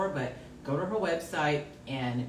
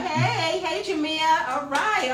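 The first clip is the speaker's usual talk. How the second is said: excited greeting; 10 semitones higher, 7 dB louder than usual. Real, not acted. Excited greeting of family member.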